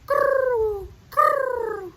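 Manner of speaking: high, loud throaty croo; a truer dove coo, rolled; better dove coo. Shown as a demonstration. Teacher correcting her dove sound, throaty croo. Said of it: "it sounds a little more like this".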